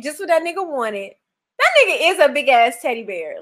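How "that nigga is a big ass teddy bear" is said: ordinary talk, nothing marked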